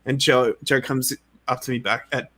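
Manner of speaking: ordinary talk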